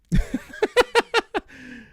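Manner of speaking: loud, high real laugh bursting out; big burst of laughter. Real, not acted. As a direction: crack up loudly at the absurdity. Laughing at the crowd filming a recording.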